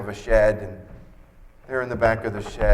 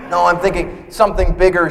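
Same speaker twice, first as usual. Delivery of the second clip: wife insisting; 9 semitones higher, 13 dB louder than usual. Retold or imitated. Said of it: "she says".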